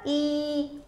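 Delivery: ई held long and level; long sound. Shown as a demonstration. Teacher answering whose sound is heard, ई drawn out. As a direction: sustain ई as one long vowel. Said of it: "long sound आ रहा है ना".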